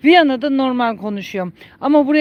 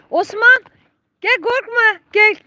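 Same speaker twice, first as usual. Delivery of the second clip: high-pitched coaxing to an animal; coaxing call; 9 semitones higher than usual. Real, not acted. Owner telling her donkey not to fear, high coaxing call. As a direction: call it over in a high, encouraging voice.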